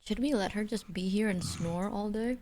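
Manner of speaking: ordinary talk, nothing marked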